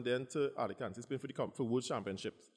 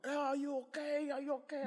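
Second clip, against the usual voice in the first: fussing crowd. Retold or imitated. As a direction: fuss over him.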